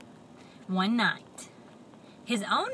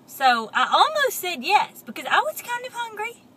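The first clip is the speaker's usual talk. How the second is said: excited; 10 semitones higher, 8 dB louder than usual. A narrator's reading. Excited confession.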